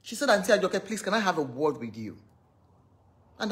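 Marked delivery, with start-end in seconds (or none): 0.3-2.2 soft, low, tearful plea; mimicking a crying girl. Retelling the crying girl's plea in a soft tearful voice. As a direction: quietly beg like a girl in tears. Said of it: "she was crying".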